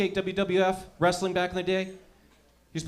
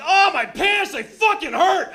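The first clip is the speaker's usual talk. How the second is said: high, loud mock agony; hammed-up yelp of an overexcited boy; overexcited kid; 12 semitones higher, 6 dB louder, slower than usual. Comic reenacting his excited boyhood self, high pained yelling. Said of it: "I was so excited".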